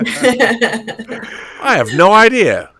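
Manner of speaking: slow, laughing admission. Real, not acted. Admitting he doesn't know her, a slow laughing reply. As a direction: let the laugh run through every word.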